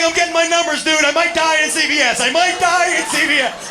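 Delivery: high, loud frantic wail; mock-panicked whining about dying; panicking brother. Brother at CVS convinced he'll die, loud mock panic.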